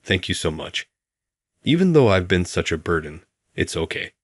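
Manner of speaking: ordinary delivery, nothing marked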